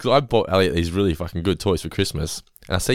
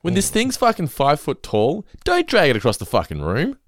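mock angry rant; 10 semitones higher, slower than usual. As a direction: scold the parent. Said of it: "I'm like".

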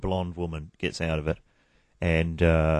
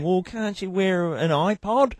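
higher, slow condescending suggestion; mock clerk; 10 semitones higher, slower than usual. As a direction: suggest it like it's obvious. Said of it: "And she's going".

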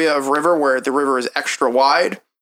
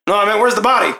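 raised, pressing guard voice; urgent questioning; 7 semitones higher, 4 dB louder, faster than usual. Acted. Guard demands to see the body, urgent.

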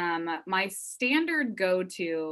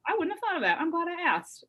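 delighted; 12 semitones higher than usual. Real, not acted. Delighted at student idea.

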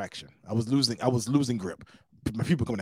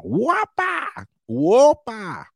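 high, louder whoop going down; mock joyful guide whoop; guide's whoppa cry; 11 semitones higher, 6 dB louder, much slower than usual. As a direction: yelp it like sliding down a waterfall. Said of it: "I think that's how he said".

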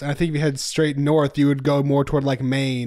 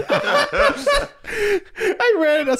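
laughing; much slower than usual. Real, not acted. Laughing at own mistake.

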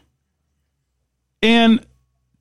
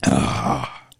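usual speaking voice

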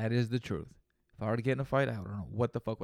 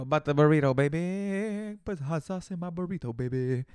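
lightly sung-out movie quote, higher; quoting a movie line; 4 semitones higher, 5 dB louder than usual. Retold or imitated. Quoting a movie line to his listeners, lightly voiced crooner. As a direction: croon the line like a film character. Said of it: "if you don't know where that's from".

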